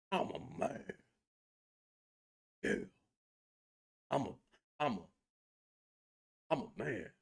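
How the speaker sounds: mock a puffed-up man demanding submission; mock macho man. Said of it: "something stupid like".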